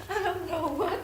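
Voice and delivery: high, soft tearful voice; breaking down in tears; crying. Real, not acted. Distressed woman breaks down crying.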